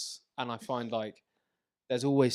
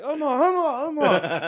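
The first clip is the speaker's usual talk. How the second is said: higher, louder laughing calls; group calling out; 14 semitones higher, 10 dB louder, slower than usual. Retold or imitated. Passengers shouting for the missing boy, laughing. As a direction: join the passengers calling the kid. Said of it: "we're all going".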